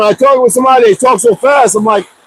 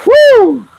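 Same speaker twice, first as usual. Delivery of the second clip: sudden joyful woo, much higher; excited high whoop; 14 semitones higher than usual. Real, not acted. Suddenly whooping with excitement at the viewers. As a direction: let out a high delighted whoop.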